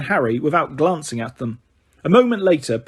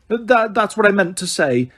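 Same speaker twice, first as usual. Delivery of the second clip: flustered, quick; 6 semitones higher than usual. A narrator's reading. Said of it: "said Missus Figg quickly".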